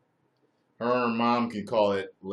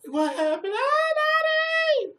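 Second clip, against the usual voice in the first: put-on much higher child voice, wailing; crying little girl; 9 dB louder than usual. Daughter crying to her father after falling, wailing. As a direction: play the hurt little daughter. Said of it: "She'll be crying and shit".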